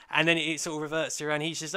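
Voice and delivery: ordinary talk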